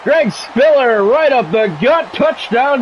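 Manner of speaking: mock broadcaster going wild on a touchdown; excited touchdown call. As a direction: call the score like a thrilled announcer. Calling a touchdown run.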